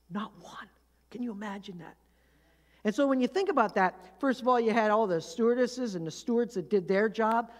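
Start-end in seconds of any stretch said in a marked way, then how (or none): 0.1-2.0 hushed, unvoiced emphasis; awed whisper. Real, not acted. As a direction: drop to a whisper for wonder. Preacher marvels that all survived.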